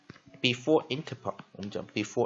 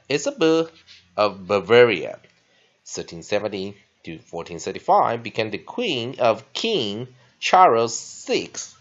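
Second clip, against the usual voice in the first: loud, clear classroom text reading; teacher reading the lesson text aloud; 8 dB louder, slower than usual. Acted. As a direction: read the passage out for the class.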